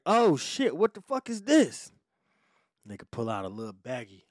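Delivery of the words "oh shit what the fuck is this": mock astonishment. Cop finding drugs in car, mock astonishment. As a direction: imitate a loud, high-pitched discovery.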